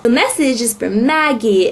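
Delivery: normal talking voice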